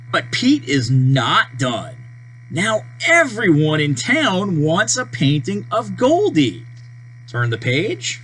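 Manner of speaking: ordinary delivery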